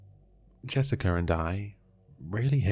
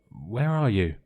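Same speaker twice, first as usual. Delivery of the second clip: a lightly raised call into the dark; mild shout; 2 semitones higher, 4 dB louder than usual. A narrator's reading. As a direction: call out, half-hearted shout. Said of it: "I shouted".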